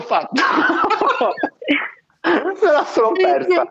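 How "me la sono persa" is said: high giggly laugh through the words. Real, not acted. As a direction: laugh while you confess it. Laughing while admitting a miss.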